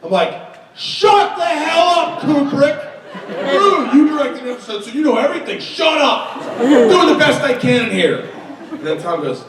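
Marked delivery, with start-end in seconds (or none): 0.8-8.3 high-pitched mock outburst; exasperated director, acted out for laughs; retold angry retort. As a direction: snap back in a hammed-up high voice. Acted retort in on-set quarrel.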